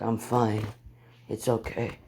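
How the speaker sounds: soft, low-key dismissal; calm reassurance. Acted. Injured hero to those helping him, calm reassurance. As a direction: gently wave off the help. Hurt hero waves off helpers.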